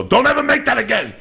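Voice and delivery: high, loud fake complaint about the meal; mock outrage. To his wife after a great meal, a hammed-up mock outrage. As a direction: pretend to be offended by a dish he loved.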